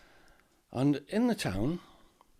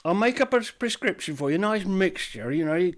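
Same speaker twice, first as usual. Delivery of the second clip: much higher, louder, eager shopkeeper; chirpy shopkeeper; 7 semitones higher, 7 dB louder than usual. Acted. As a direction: greet him like a fussy shopkeeper. Apothecary delighted by order.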